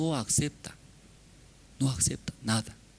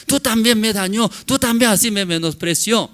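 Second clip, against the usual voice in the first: high, loud protest of being wronged; indignant counter-accusation; 9 semitones higher, 7 dB louder than usual. Acted. Husband itching to accuse his wife back, mock indignant. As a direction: hurl the blame back, acted.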